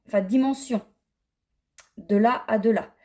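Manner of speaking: normal talking voice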